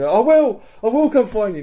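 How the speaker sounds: very high, loud imitated captive; mock desperate promise. Captive being led away answers his helper, mock desperate promise. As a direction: promise eagerly, voice pushed right up.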